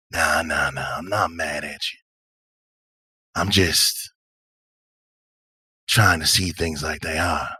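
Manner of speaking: low, gentle, unhurried character voice; laid-back drifter, soothing. Acted. Patch reassures ashamed Nina and the strangers, soothing. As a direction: ease off, with long pauses.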